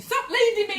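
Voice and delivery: high, loud imitation of an exasperated partner; mock complaint. Husband complaining about being led, mock indignant. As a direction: burst out with it as a retold protest.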